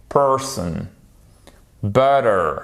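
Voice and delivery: Person and Butter with a full American r; strong English R. Shown as a demonstration. Teacher modelling ER for learners, strong English R. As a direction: really sound the r in Person and Butter. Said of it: "faut vraiment faire le R".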